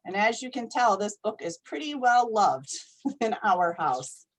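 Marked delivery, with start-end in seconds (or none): none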